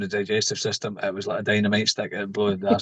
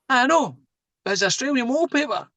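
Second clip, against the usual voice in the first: teasing insistence; 12 semitones higher than usual. Retold or imitated. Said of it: "insisting to mum".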